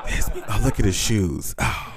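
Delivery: lightly voiced snooty girl sniffing at a guy; a hint of a judgy woman dismissing him; mild mock disdain; mocking snobby woman. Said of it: "And you went".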